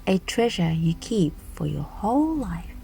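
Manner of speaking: usual speaking voice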